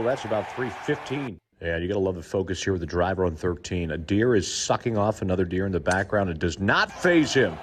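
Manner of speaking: usual speaking voice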